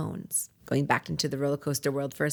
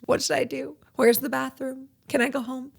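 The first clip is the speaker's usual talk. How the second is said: higher, a touch panicky; mock nervous; 8 semitones higher than usual. Beginner fretting in the cubby room, mock panicky. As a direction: play the jittery newbie lightly. Nervous first-timer at studio.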